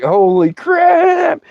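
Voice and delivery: high, very drawn-out exclamation; amazed. Retold or imitated. Storyteller reacting as the noise goes again, drawn-out amazement. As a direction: stretch it out in high astonishment.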